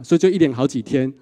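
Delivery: ordinary delivery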